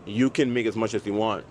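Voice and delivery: ordinary talk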